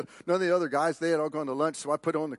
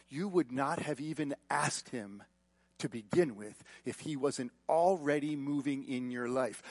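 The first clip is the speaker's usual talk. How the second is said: ordinary talk, nothing marked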